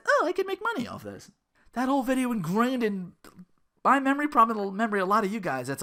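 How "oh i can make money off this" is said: high sarcastic imitation of him; mock greedy streamer. Mocking the streamer's cash grab in a high voice. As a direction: sneer his line back at him. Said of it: "he decides".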